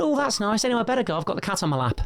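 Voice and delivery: high, breezy mum shrugging it off; mum's voice. Retold or imitated. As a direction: play mum as lightly unimpressed. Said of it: "And she went".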